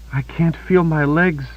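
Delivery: paralysed Kaelin realizing she cannot feel her legs; rising panic. A narrator's reading. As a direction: realize with horror, still half disbelieving. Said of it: "Her voice quivered with panic".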